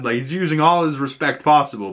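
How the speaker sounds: ordinary talk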